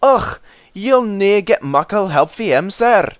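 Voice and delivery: high Scottish engine voices; Scottish twins. A narrator's reading. Twins answer the Fat Controller in a Scottish brogue.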